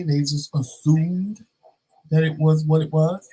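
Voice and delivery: ordinary delivery, nothing marked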